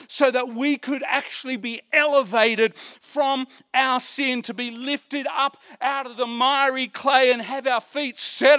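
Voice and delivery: loud, high, rising preacher's climax; fervent pulpit preaching; preaching at full force. Acted. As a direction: build the gospel to a shout.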